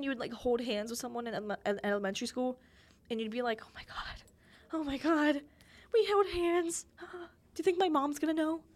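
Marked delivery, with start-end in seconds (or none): 3.6-4.2 whispered gasp. Retold or imitated. Kid gushing over held hands. 4.7-8.6 put-on excited kid panic. Kid panicking over held hands.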